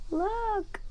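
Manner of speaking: ordinary delivery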